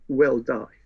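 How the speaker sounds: ordinary delivery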